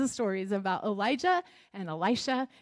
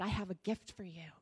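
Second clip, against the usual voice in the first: tender; 2 semitones lower, 8 dB quieter than usual. Acted. Jesus offers gift.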